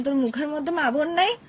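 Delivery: ordinary talk, nothing marked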